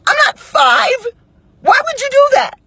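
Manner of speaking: very high, outraged pitch; high incredulous outrage; indignant protest. Retold or imitated. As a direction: protest at being treated like a child. Retelling her indignant protest.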